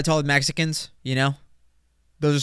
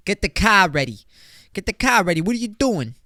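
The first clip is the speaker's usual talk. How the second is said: put-on pushy, louder Boston accent with a dropped r in "car"; 6 semitones higher, 3 dB louder than usual. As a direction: bark it like an angry guy from Boston, a bit Italian. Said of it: "that was like Boston mixed with, like, Italian".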